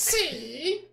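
high puppet voice; drawn-out high dummy answer; Kleber. Acted. Puppet boasting of many moves, high puppet voice. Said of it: "acha uma voz que pareça com o boneco".

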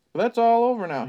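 put-on folksy old local spinning a tale; old country man. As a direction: spin it like a creepy small-town storyteller. Ned's line in character.

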